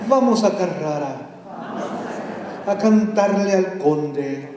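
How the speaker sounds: slow, rhythmic Spanish poem reading; dramatic Spanish verse reading; poem recital. Acted. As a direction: chant the verses like an incantation. Reads a Spanish poem aloud.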